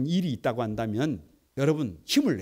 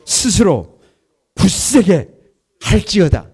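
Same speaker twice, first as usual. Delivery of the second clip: loud unison reading; 12 dB louder, slower than usual. Real, not acted. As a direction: shout the verse out together, louder. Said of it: "다 같이 한번".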